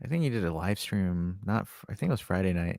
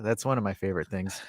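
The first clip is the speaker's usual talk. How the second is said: usual speaking voice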